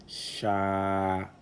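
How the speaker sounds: "sha" with a long, level a; lengthened A vowel. Shown as a demonstration. Teacher reading the word syllable by syllable, drawn-out "sha". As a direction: hold the a in "sha" well past a normal syllable. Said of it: "l'outil d'allongement à la voyelle A".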